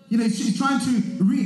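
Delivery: usual speaking voice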